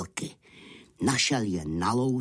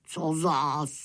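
mildly grumpy wolf, a bit higher; growling wolves; 5 semitones higher than usual. A narrator's reading. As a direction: grumble with a touch of growl. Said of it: "zavrčeli vlci". (mírně nevrlé vlčí zavrčení)

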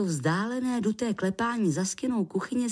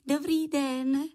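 high, awkward little giggle into a hello; sheepish greeting; 8 semitones higher, slower than usual. A narrator's reading. Lichožrout introduces himself to a puzzled man, sheepish. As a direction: greet him with an embarrassed titter. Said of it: "rozpačitě se usmíval".

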